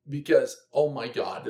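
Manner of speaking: ordinary talk, nothing marked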